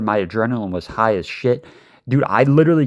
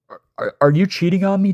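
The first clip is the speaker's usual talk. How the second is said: much higher, stuttering jealous outburst; jealous boyfriend to his girl, higher and agitated; jealous accusation; 8 semitones higher, faster than usual. Retold or imitated. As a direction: accuse her, half-choked with suspicion.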